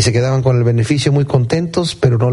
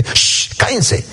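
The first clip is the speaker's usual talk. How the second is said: impatient shush, then higher-pitched order; hushing; 5 semitones higher, much slower than usual. Acted. Crowd scolding the blind men, hushing. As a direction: shush them like an annoyed crowd. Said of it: "Y la gente".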